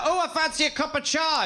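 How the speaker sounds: high British mum voice; English mother's accent. Retold or imitated. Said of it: "mother never really adopted American English".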